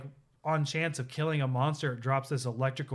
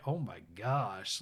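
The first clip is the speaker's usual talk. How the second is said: lower, softer, slow oh my gosh; stunned; 5 semitones lower, 5 dB quieter, slower than usual. Retold or imitated. Player watching the dark cutscene, stunned and hushed. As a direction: let it out quietly in shock. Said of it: "And I'm just like".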